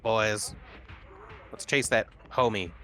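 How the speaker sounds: ordinary delivery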